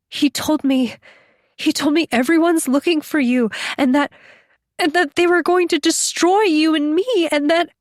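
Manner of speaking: high, tearful voice climbing as it goes; breaking down. A narrator's reading. Captive tells her rescuer of threats of destruction, breaking down. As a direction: stammer on toward tears, pitched well up. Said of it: "she broke down crying".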